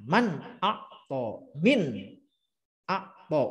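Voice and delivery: man akto, min akto read slowly and clearly; slow recitation. Shown as a demonstration. Teacher showing the acceptable readings, slow. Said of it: "min boleh".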